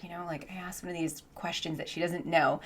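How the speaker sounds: whispered confession. Real, not acted. Confessing a bad question to viewers, whispered. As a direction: hush it like a secret. Confiding aside.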